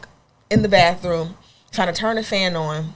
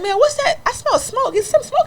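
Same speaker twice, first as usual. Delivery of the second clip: much higher mock mom voice; imitating mom. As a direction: play the suspicious mother sniffing the air. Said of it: "she's like".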